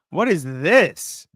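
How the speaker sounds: slow, drawn-out bafflement; baffled. Real, not acted. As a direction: stretch it out in disbelief. Baffled by on-screen chat.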